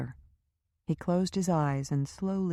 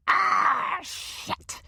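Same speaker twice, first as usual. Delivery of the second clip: a much higher, louder cry of agony breaking off into a rasp; agonized shout; 4 dB louder than usual. A narrator's reading. Powers yelling as Lynch presses harder on his shoulder, agonized shout. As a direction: yell it through the pain. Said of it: "the sheer agony in his voice".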